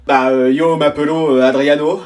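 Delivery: slow, hammed-up fake Spanish; fumbling a fake Spanish self-introduction; mock Spanish. Pupil showing off his bad Spanish, mock Spanish accent. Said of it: "Et toi, en espagnol?".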